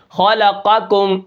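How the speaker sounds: slow خلقکم with thick خ and ق; slow recitation. Shown as a demonstration. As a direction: spell out the heavy letters of خلقکم. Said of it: "جیسے".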